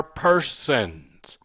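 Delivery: persons drawn out slowly; stress on persons. Shown as a demonstration. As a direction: make persons the key word. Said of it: "the reason I emphasize the word".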